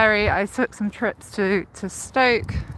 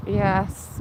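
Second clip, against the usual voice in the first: ordinary delivery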